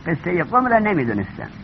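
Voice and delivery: normal talking voice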